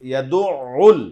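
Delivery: ইয়াদুউল with the ain sound kept open; right way to say ইয়াদুউল; correct pronunciation. Shown as a demonstration. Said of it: "উচ্চারণ হবে".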